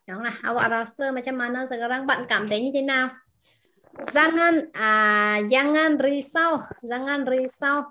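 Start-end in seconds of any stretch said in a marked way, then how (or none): 3.9-7.8 slow model reading of jangan risau; teacher repeating jangan risau slowly; slow demo. Shown as a demonstration. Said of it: "yên tâm hoặc là đừng có lo lắng".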